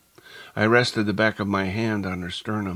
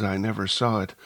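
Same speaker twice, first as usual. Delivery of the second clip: ordinary delivery, nothing marked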